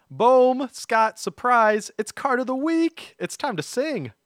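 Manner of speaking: high, loud, stretched-out hype; excited announcement. Real, not acted. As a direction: boom it out like a surprise reveal. Announcing the card of the week.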